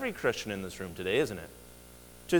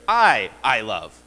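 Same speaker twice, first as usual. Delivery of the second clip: "I, I love" spoken very slowly, the repeated "I" pressed hard; emphatic doubled I; 6 semitones lower, 6 dB louder, much slower than usual. Shown as a demonstration. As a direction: hammer the I twice. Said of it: "The point is to make things emphatic".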